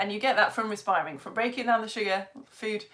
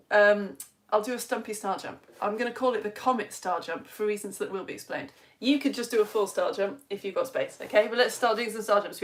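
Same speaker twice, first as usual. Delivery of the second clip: ordinary talk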